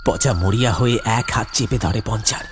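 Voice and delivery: ordinary delivery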